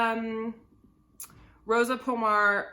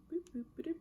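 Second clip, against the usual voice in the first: soft unvoiced boop noises; whispered boops; 8 semitones higher, 10 dB quieter, much faster than usual. Real, not acted. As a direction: tap out little whispered boops. Playful sound effect for hem.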